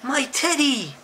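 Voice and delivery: high-pitched storybook exclamation; cried out. Acted. Eddie finds his teddy, crying out. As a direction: cry out high in excitement. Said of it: "cried Eddie".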